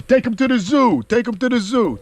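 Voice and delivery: loud, high movie-boxer impression; Rocky. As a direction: shout it out as the slurred boxer. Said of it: "That's how Rocky talks".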